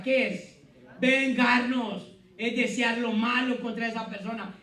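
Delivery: loud, high sermon voice, slowing on the Lord's words; preaching at full force. Acted. Pastor urging the congregation to forgive, full preaching voice. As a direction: drive the point home from the pulpit.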